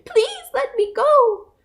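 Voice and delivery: high, slow tiny voice; pleading mouse. A narrator's reading. Mouse begging the lion, high and slow. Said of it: "begged Mouse".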